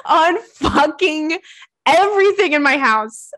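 high, wailing mock cry over the fur; mock despair. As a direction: whine it like a hammed-up breakdown. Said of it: "and you're like".

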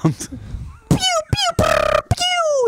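rising pew-pew blaster noises; gun sound. Shown as a demonstration. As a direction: fire off toy-gun pews, last one high. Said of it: "that's my machine gun".

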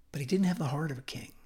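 fast, hushed whisper. Real, not acted. The preacher revealing Saul's flaw to the congregation, whispered. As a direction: drop to a secretive whisper. Dramatic aside.

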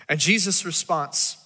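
usual speaking voice

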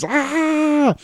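short very high yelp; sharp high cry of pain; high scream. Retold or imitated. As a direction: scream as hot coffee spills. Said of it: "and he screams".

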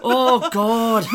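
high, loud Glaswegian Billy Connolly voice; Billy Connolly Scottish accent. Retold or imitated. Voicing Cho Chang to Harry in a Scottish accent. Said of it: "I gave Cho Chang Billy Connolly's accent".

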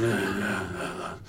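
low, fading grunts; quiet mock mumbling; mumbling weirdo. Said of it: "mumbling and walking towards me".

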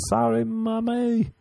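put-on high, drawn-out childish apology; mock little boy. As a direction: whimper it like a child caught out. Imagines himself waking as a child.

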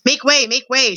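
restrained crowd-clearing shout. A narrator's reading. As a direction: call out to clear the way. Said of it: "shouted Furlow".

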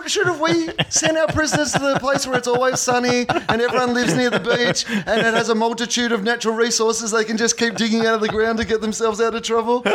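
high, sarcastic English voice through laughter; hammed-up English voice, laughing as he goes; mock English settlers. Mocking the English staying behind.

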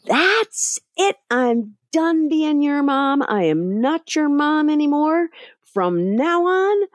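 put-on fed-up mom snapping at the kids; snapped. Worn-out mother snapping at her nagging kids, high and drawn out. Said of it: "I just kinda snapped".

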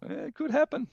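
sudden high-pitched yelp of hope; a high, hopeful squeal at the idea; high squeak. Acted. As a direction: squeak it out in disbelief. Excited hope about the team's chances.

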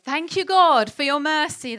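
a mock Greek thank-you, overdone on purpose; bad Greek accent. Preacher jokingly thanks God in a bad Greek accent. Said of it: "Not one of my accents".

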